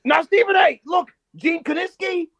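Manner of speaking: slowed mock yell at Stephen A; Mad Dog yelling. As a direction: holler names like a radio host. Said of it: "yelling all these fucking wrestlers".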